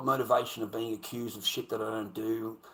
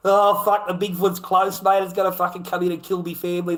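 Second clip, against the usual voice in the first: mock panic; 7 semitones higher, 10 dB louder than usual. Foreigners told about Bigfoot.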